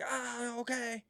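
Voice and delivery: high-pitched but soft imitated outburst. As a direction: mimic an outburst without real volume. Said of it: "he's loud. He's like".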